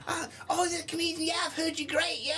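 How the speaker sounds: high, mock gushing praise; mock gushing Patrick. Host mocking Patrick's fake praise in a high voice. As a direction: ham up the fake flattery.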